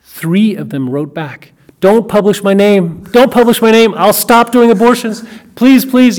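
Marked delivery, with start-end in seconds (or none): 1.8-6.2 frantic pleading. Retold or imitated. Doctors pleading to keep names hidden.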